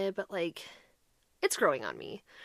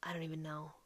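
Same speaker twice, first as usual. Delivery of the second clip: whispered aside; 5 semitones lower, 16 dB quieter than usual. Real, not acted. Aside about the trade-in value.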